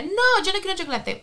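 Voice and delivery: put-on high, childlike refusal; child's voice. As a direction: imitate a little girl saying no. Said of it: "Y la dice Emilia". (imitando a una niña que dice que no)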